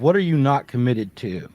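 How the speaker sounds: ordinary talk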